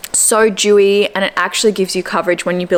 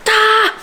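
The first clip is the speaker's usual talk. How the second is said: dismayed cry; 3 dB louder than usual. Retold or imitated. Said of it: "I was like".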